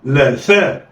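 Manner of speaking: لا ثاء snatched together; blended quickly. Shown as a demonstration. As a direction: run لا and ثاء into one. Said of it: "يلا، اخطف".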